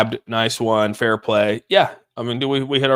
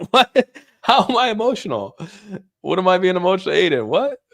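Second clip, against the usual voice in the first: much higher, laughing "what?"; laughing disbelief; 8 semitones higher than usual. Real, not acted. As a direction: caught off guard, laughing it off. Chat calls him emotional.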